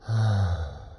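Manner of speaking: a sleepy Sunday-morning "uh"; relaxed schwa. Shown as a demonstration. Teacher demonstrates the gentle first sound, relaxed. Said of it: "Mine sounds like this".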